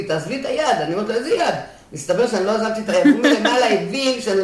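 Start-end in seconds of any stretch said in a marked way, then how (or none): 0.0-0.8 husband's retold shout. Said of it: "בעלי מהמדרגות צועק לי". 1.1-1.5 confused question. Retold or imitated. Confused reply to husband.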